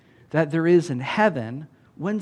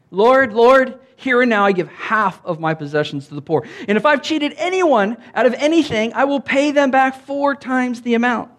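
higher, earnest outburst; eager pledge; 8 semitones higher, 4 dB louder than usual. Acted. Zacchaeus pledges his wealth to the Lord, excited.

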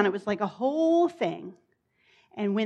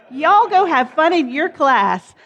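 loud, slow call across the room; 6 semitones higher, 11 dB louder, slower than usual. Real, not acted. Pastor sends kids to class.

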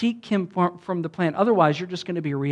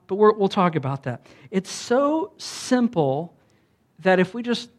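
ordinary delivery

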